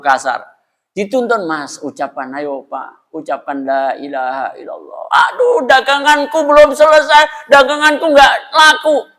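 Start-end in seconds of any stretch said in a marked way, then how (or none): 1.5-5.0 gentle pleading guidance. Retold or imitated. Guiding a dying man. 5.3-9.1 fretting dying trader. Retold or imitated. Dying trader answers, worried.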